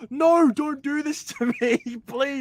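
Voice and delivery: high, half-laughing mock protest; acted pleading. Fan begging the movie not to cut away, mock-desperate. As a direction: beg in exaggerated dismay. Acted frustrated plea at the ending.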